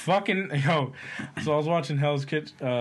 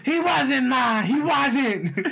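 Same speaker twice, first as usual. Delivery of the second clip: high, louder mock protest to ma; whiny son; 11 semitones higher, 5 dB louder, much slower than usual. Son arguing with mom about his brother, high whine.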